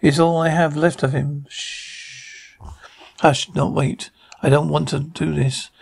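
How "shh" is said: very soft, drawn-out shush; hushing shh. Acted. Hushing someone before they can protest, very soft shush. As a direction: shush them under your breath.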